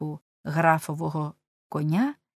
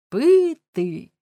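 loud, high-pitched call; shouting; 9 semitones higher, 7 dB louder than usual. A narrator's reading. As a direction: call out for a drink from horseback. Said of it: "звівшись на стременах, крикнув". (гучний високий оклик)

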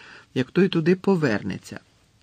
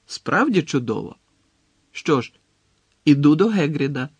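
confident exclamation, building louder; exclaiming; 2 semitones higher, 5 dB louder than usual. A narrator's reading. Harry announces his plan to his friends, exclaiming. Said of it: "вигукнув він".

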